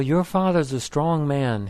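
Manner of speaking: ordinary delivery, nothing marked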